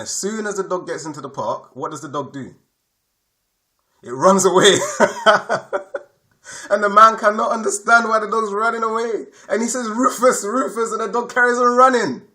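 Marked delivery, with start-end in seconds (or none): 4.0-12.2 higher, laughing through the words; laughing while telling. Real, not acted. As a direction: laugh as you describe the dog bolting. Laughing at the runaway dog.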